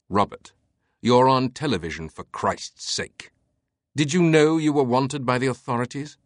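put-on a hard, unsoftened older man; stern Teabing. A narrator's reading. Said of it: "Teabing's tone did not soften".

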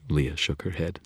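ordinary delivery, nothing marked

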